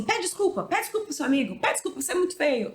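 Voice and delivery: high-pitched mock parent: pede desculpa!; mother telling her child off to apologize, mimicked high; nagging parent.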